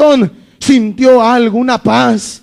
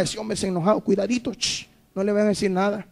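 much softer, lower warning murmur; hushed gossip; 3 semitones lower, 13 dB quieter, faster than usual. Retold or imitated. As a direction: warn each other under your breath. People murmur about an angry man.

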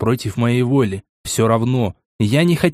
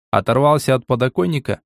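usual speaking voice

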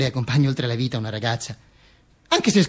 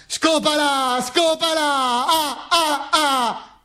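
high, drawn-out jeering chant; crowd egging on; 10 semitones higher, much slower than usual. Acted. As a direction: goad him like a rowdy crowd, long high vowels. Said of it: "mi incita". (incitamento da folla, acuto e strascicato)

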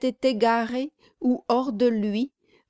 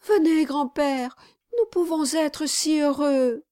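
pleading; 7 semitones higher than usual. A narrator's reading. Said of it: "le supplia encore".

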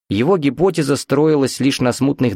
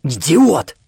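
high, croaked insult; dry-mouthed hoarse exclamation; wheezing; 6 semitones higher, 4 dB louder than usual. A narrator's reading. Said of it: "просипел он".

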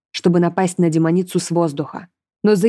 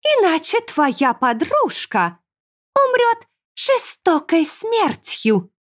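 high, slow, taunting threat; mocking demoness; 9 semitones higher, much slower than usual. A narrator's reading. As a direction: threaten with relish, stretching the words. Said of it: "издевательски сказала Берклея".

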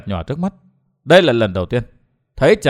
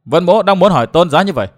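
usual speaking voice

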